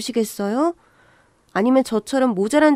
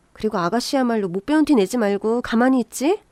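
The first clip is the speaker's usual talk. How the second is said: ordinary delivery, nothing marked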